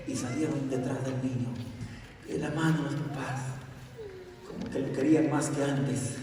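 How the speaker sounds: ordinary delivery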